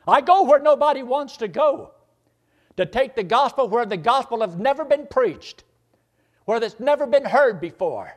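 high, loud impassioned cry as Paul; impassioned preaching. Acted. Preacher voicing Paul to his congregation, high and loud. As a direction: shout it like Paul pleading his calling.

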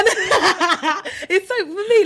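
high giggly laughter; laughing. Real, not acted. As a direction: laugh through the words. Laughing after the mock question.

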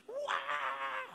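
high mock infant cry; long baby "waaah"; imitated baby wail. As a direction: keep bawling like a baby. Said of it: "nangis terus".

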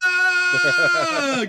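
high, loud yell; mock scream. Host reacting to co-host's joke, high mock scream.

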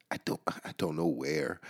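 conspiratorial whisper; hushed whisper. Real, not acted. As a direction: drop to a secretive whisper. Joking he's on a list.

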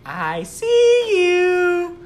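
imitating a toy's eerie child voice; creepy kid voice. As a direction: drag it out like a haunted toy. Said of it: "all of a sudden you hear".

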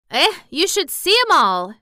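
put-on chatty Yorkshire servant girl, rattling on; Yorkshire maid gossiping cheerfully; Martha's Yorkshire voice. A narrator's reading.

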